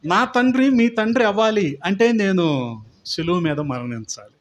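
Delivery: loud, driving sermon climax; preaching at full force. Acted. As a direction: proclaim it to the church, building in force. (బలంగా, ఉద్వేగంగా ప్రసంగించడం)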